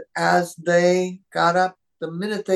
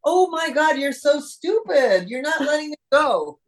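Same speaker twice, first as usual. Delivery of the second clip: high mock-exasperated dog; frustrated dog voice; 10 semitones higher than usual. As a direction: play the fed-up dog, pitched high. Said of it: "So I would start out with".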